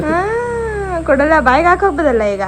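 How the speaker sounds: loud, fast playful teasing. Real, not acted. Mother playfully scolding baby.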